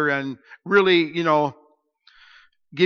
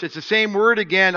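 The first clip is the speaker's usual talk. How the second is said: ordinary talk, nothing marked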